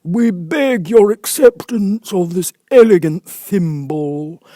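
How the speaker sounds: high, loud, slow ceremonial address; solemn Dodo speech. Acted. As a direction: present it with pompous ceremony. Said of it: "this short speech".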